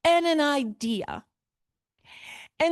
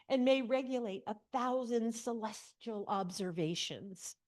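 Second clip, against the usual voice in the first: reading the passage much softer, almost a whisper at times; hushed reading; 3 semitones lower, 6 dB quieter than usual. Acted. Teacher rereading the letter to the class, hushed. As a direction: read the dreamy letter in a hush.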